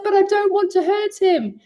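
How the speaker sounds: retelling her own pleading protest to the coach, high and pleading. Retold or imitated. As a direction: protest as if begging not to hurt him. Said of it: "And I said".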